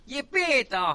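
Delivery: high, loud cartoon puppet voice; Pinocho voice. Imitating how Pinocchio calls to his father. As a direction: imitate the Argentine-dubbed Pinocchio crying out. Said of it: "Así habla Pinocho".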